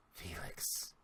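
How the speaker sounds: a breathless, hushed whisper of his brother's name; stunned whisper. Acted. Mitchell recognizing his brother, stunned whisper. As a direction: barely voice it, in shock.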